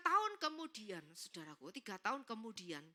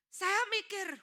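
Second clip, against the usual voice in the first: loud, high outburst; emphatic repetition; 8 semitones higher, 6 dB louder, slower than usual. Retold or imitated. Preacher tells congregation he was thinking, emphatically. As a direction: punch the thought out loudly.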